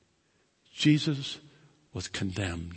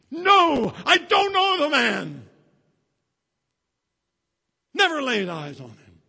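very high, loud furious denial; cursing denial; 13 semitones higher, 14 dB louder than usual. Acted. Peter swearing to the crowd he never knew Jesus, furious shout. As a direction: swear it off in a panic. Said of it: "we curse and swear".

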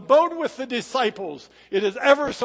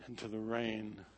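ordinary talk

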